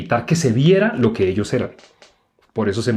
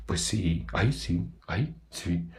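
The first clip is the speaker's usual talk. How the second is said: much softer, sheepish muttered yes; sheepish admission; 3 semitones lower, 12 dB quieter, much slower than usual. Retold or imitated. Guilty consciences of the accusers conceding, soft and sheepish. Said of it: "qué contestó la conciencia de ellos".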